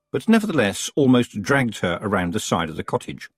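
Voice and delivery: ordinary delivery, nothing marked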